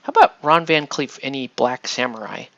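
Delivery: normal talking voice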